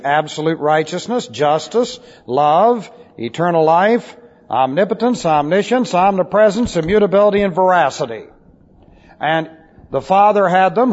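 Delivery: ordinary talk, nothing marked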